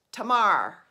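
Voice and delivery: Tamar clipped to two syllables, loud; mock Baltimore Tamar, belted out; imitating mother-in-law's Baltimore Tamar; Baltimore accent. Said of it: "My mother-in-law says".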